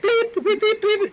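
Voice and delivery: high, loud imitated censor beeps; censor bleeps. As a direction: mimic the TV censor tone, beep beep. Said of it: "it would be like".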